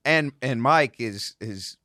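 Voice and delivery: ordinary talk, nothing marked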